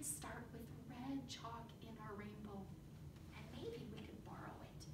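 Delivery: quick whispered aside; whispering. Acted. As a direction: share it like a secret. Conspiratorial aside to kids.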